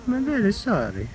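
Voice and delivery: sing-song high sorry. Real, not acted. Gardener apologizing to a baby bunny, high baby talk. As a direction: coo it up high like to a pet.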